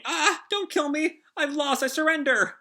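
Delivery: high mock-terrified pleading; hammed-up panicked surrender; Nami. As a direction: beg for your life in a high pretend panic. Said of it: "I think that's Nami".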